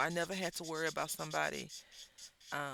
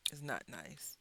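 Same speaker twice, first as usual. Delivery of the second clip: whispered aside, voice dropped; hushed confiding whisper; 5 semitones lower, 8 dB quieter than usual. Real, not acted. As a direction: breathe the words out under your breath. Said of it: "drops to whisper".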